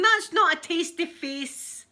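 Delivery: high, loud and slow teasing; teasing retort. Retold or imitated. Telling the coworker his face gave him away, high and loud. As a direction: call out the fib with glee.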